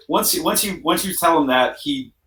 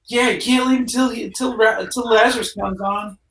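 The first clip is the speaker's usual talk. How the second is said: eager clubgoer; 11 semitones higher than usual. Acted. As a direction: hype up the DJ's arrival. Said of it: "somebody else is like".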